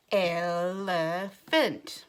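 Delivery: ELEPHANT stretched into three even chunks; very slow, monotone robot ELEPHANT; robot talk. Shown as a demonstration. Teacher saying it with the children, hand under chin, slow robot ELEPHANT. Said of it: "like we are a robot".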